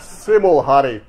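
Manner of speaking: loud, slow, amused chortle; chortling. A narrator's reading. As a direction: chortle it out fondly to an old regular. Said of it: "Tommy chortled".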